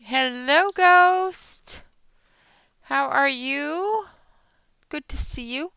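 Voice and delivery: high, loud, delighted hello; light up as a friend walks in; excited greeting. Real, not acted. Streamer greets viewer Ghost in chat, bright and excited.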